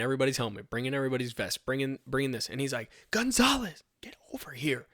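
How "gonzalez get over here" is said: whispered summons. Retold or imitated. Registration guy pulling him aside, whispered call. Retold boss calls him over.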